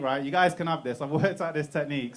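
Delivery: ordinary talk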